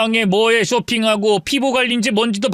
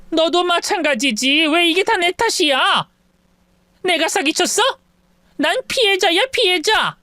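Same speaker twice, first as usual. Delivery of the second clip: higher, rising indignation; indignant protest; 6 semitones higher than usual. A narrator's reading. Mother protesting to her son, indignant. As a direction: insist you're the victim, heated.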